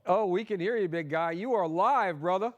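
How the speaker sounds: high, loud call down the line. Real, not acted. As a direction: project to a guest on a patchy connection. Calling to guest on remote link.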